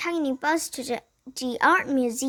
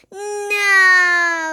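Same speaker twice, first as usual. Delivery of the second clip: long, loud, high wail; hammed-up drawn-out protest; 5 semitones higher, 6 dB louder than usual. A narrator's reading. Girl sent back to school, long dismayed wail.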